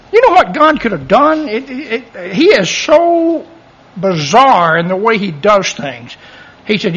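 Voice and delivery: ordinary talk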